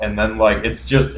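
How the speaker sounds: ordinary delivery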